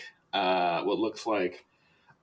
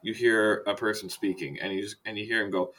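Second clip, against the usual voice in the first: ordinary delivery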